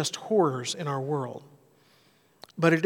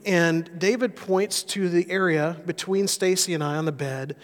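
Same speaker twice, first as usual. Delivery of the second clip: normal talking voice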